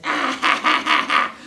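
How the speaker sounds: high, loud staccato cackle; taunting cartoon laugh on the run; cat's mocking laugh. Cat laughs while fleeing.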